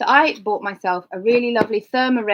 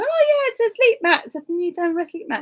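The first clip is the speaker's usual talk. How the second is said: bright eager reply; 9 semitones higher, 5 dB louder than usual. Retold or imitated. Her own cheerful reply retold.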